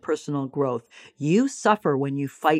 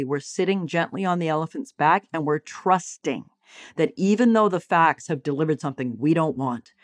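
ordinary talk, nothing marked

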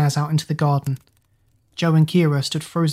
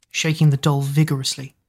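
ordinary delivery, nothing marked

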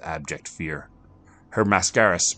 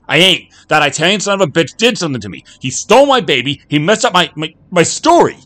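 raging high-pitched shout, rushed at first; 15 dB louder than usual. Acted. Jack ranting at the bartender about his stolen life, raging shout. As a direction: scream it all out, nearly unhinged. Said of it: "shouted Jack".

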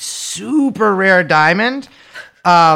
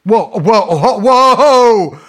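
rising, louder string of whoas; mock alarmed interruption; 2 semitones higher, 5 dB louder, much slower than usual. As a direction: halt them with escalating, drawn-out whoas. Cutting in to halt co-host.

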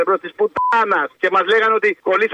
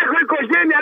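higher, pleading retold voice of a family man; imitating a worried soldier; 7 semitones higher than usual. Caller to radio host, mimicking a soldier's plea, higher and pleading. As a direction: mimic the scared soldier who has a family.

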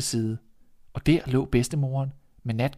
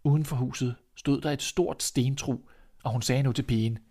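plain reading voice

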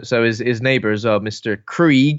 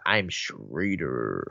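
much lower, softer, very slow voice; mock deep intro; 10 semitones lower, 8 dB quieter, much slower than usual. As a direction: drop it low and drag it out. Acted intro as character.

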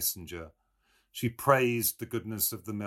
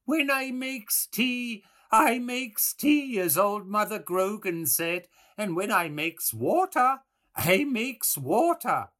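put-on wheedling old woman; 9 dB louder than usual. A narrator's reading. Mulligan hewing bread, imitating old Mother Grogan, wheedling. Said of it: "said in an old woman's wheedling voice".

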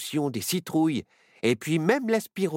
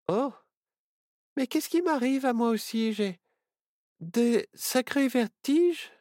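dizzy; 7 semitones higher than usual. Acted. Children getting dizzy from drug.

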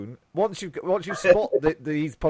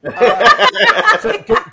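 laughing; 14 dB louder, much slower than usual. Real, not acted. Host cracks up laughing.

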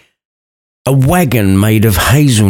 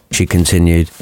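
usual speaking voice

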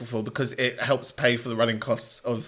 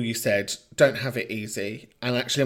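ordinary talk, nothing marked

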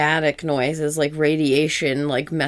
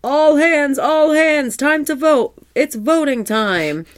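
high, loud captain shout; captain's call; 11 semitones higher, 6 dB louder, slower than usual. Retold or imitated. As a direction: bellow it across the deck. Voicing a cartoon captain call.

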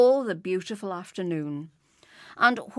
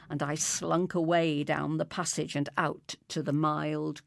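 ordinary delivery, nothing marked

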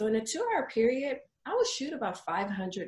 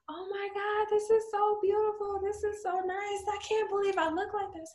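sustained high-pitched gushing; overjoyed client; 12 semitones higher than usual. Retold or imitated. Client at the reveal of her photos, overjoyed gushing. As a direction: imitate an amazed client far above your own pitch.

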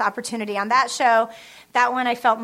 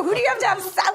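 exasperated, high; 9 semitones higher, faster than usual. Retold or imitated. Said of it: "I was about to say".

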